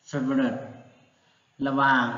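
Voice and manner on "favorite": "favorite" as FEV-ret, falling at the end; native English pronunciation. Shown as a demonstration. Teacher repeats the Western way, "favorite" said as FEV-ret. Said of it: "ฝรั่งมันจะออกเสียงเป็น".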